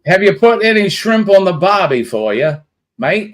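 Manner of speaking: put-on terrible Australian accent. Host teasing viewer Joe in a terrible Australian accent. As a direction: ask it like a cartoon Aussie at a barbie. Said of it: "my terrible attempt at doing an Australian accent".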